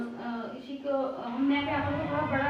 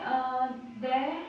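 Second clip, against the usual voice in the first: stretched syllable; much slower than usual. Shown as a demonstration. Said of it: "हमने दे में रे का आंकड़ा लगाया है".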